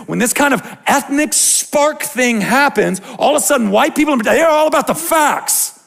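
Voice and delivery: high, loud preacher's cry; loud, high rising sermon peak; fervent preaching. Acted. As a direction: drive the point home from the pulpit. Pastor preaching at full force.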